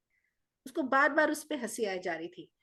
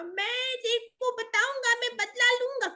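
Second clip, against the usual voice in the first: tiny monster's revenge vow; 13 semitones higher, 4 dB louder than usual. Acted. The little Bogart vows revenge while flying away, high squeaky. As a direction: wail it out high as you fly off.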